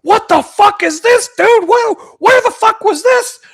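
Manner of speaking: high, loud mock outrage; going off, imitated. Buddy going off at a fake clip, hammed-up shouting. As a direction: imitate a friend shouting in disbelief. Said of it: "going off".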